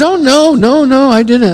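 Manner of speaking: high-pitched protest, imitating the girl; flustered denial. Girl denying it to her pastor, imitated high protest. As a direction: play her hurried, defensive denial.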